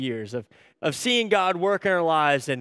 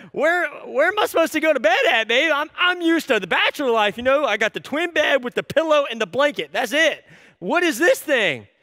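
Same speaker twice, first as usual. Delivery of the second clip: high-pitched, put-upon husband protest; hammed-up bewildered complaint; mock exasperation; 6 semitones higher than usual. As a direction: whine comically about the bed. Acted husband protesting to his wife.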